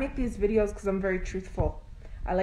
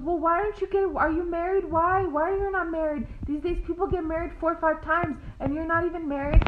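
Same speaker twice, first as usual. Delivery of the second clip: put-on higher, prying older-lady voice; imitating the nosy lady; 7 semitones higher than usual. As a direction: needle her about not being married. Lady's nosy questions, high voice.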